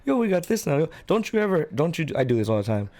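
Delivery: ordinary talk, nothing marked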